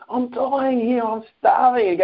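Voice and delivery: high-pitched, distraught pleading; retold wail of hunger, not real crying; upset complaint. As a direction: act out a desperate whine, pitch way up. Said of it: "I'm upset, I'm crying".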